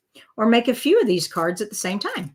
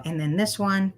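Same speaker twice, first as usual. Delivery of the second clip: usual speaking voice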